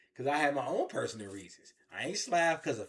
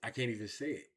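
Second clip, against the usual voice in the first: ordinary delivery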